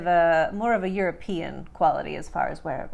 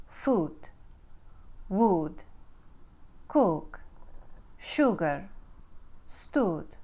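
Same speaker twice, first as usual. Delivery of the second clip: Ukrainian-accented word drill, tight OO vowels; one word at a time, Ukrainian accent with OO; 4 dB quieter, much slower than usual. Shown as a demonstration. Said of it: "one simple uh to oo".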